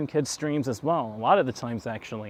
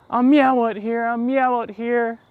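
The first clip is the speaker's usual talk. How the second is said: much higher, loud mock streamer voice; Action Kid impression; 10 semitones higher, 6 dB louder than usual. Vlogger repeating his buddy's catchphrase, high and loud. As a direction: squeak the catchphrase up high for laughs. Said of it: "That's probably something he would say".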